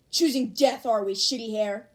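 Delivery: loud, taunting menace; menacing threat. Acted. Bakugo threatening shitty hair, menacing. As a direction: dare him to keep talking.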